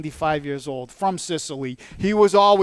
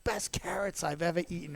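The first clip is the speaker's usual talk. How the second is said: much softer, breathy whispered wonder; hushed awe; 14 dB quieter than usual. Retold or imitated. Recalling tasting carrots at dinner, hushed whisper. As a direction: whisper it like a secret treat. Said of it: "I was like".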